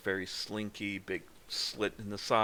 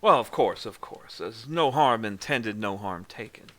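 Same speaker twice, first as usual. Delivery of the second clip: placated Southern gentleman; 7 dB louder than usual. Acted. Buford, placated, answers in character.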